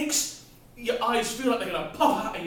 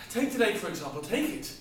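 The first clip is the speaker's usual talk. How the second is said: ordinary talk, nothing marked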